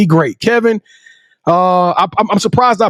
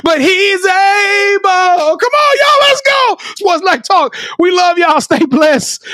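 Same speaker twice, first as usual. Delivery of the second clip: high, sing-song shouted farewell; rousing, high-pitched closing call; hype sign-off; 7 semitones higher, slower than usual. Real, not acted. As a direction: belt out the sign-off with stretched endings.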